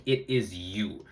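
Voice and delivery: IS YOU slowed right down and low; emphatic. Real, not acted. YouTuber driving home accountability to viewers, slow emphasis. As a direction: lean slowly on "It is you". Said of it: "I'm emphasizing this point on accountability".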